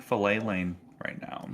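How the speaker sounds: ordinary delivery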